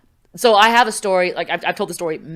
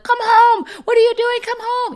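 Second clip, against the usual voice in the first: shrill high mocking mom voice, much higher; nagging mom; 6 dB louder than usual. Annoyed teen mimics her mom calling her home. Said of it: "she's constantly like".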